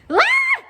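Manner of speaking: loud, very high scream; short piercing girl's shriek. A narrator's reading. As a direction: scream out at a sudden yank. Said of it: "screamed Leslie".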